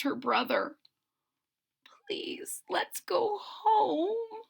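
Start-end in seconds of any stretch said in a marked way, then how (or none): 1.8-2.6 whispered plea. A narrator's reading. Scared kitten pleads. 2.7-4.4 high, very slow tearful plea; frightened kitten begging brother; crying mew. A narrator's reading. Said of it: "mews Sarah".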